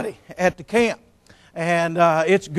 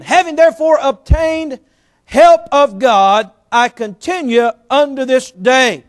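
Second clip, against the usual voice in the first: higher, louder, slow solemn reading; scripture reading; 5 semitones higher, 6 dB louder than usual. Acted. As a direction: proclaim the verse from the pulpit. Rereads the verse.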